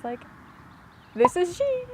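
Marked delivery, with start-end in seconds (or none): 1.1-1.8 high-pitched, self-mocking nervous reply; exaggerated awkward phone answer, pitched way up; retold nervousness. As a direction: squeak it out, mock-nervous. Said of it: "I was so nervous".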